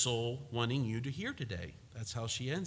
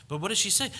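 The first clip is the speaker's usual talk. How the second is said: ordinary delivery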